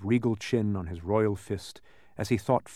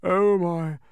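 high, loud overfull groaning words; acted breathless discomfort; labored breathing; 11 semitones higher, 6 dB louder, much slower than usual. A narrator's reading. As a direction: struggle for breath on each word. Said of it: "breathing with great difficulty".

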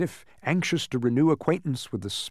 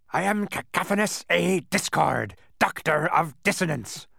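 roaring; 6 semitones higher than usual. A narrator's reading. The doctor introduces himself to Milo, roaring. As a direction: proclaim the title with pride. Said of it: "roared the man".

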